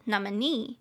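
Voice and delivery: "nominee" leaning on the last NEE; stress on last syllable. Shown as a demonstration. Teacher repeating "nominee", stressing the final NEE. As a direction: put the weight on NEE in "nominee". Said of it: "重音节在最后".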